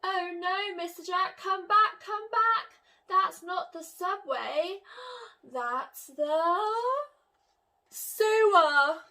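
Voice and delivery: high, loud alarmed call; rising to a loud cry of sewer. Acted. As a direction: shout him back in alarm. Miss Jones calling out again.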